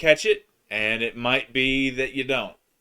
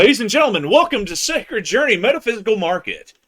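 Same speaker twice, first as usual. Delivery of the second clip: loud, high announcer voice; 9 semitones higher, 7 dB louder, faster than usual. Pretend. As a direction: announce it to the whole crowd. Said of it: "my best D J voice".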